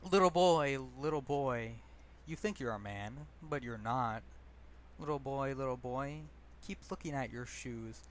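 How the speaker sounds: measured recital with long pauses between lines; poem recitation. Acted. Poet addressing himself as little boy, measured recitation. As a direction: recite it as a refrain-led poem, pausing after each jab.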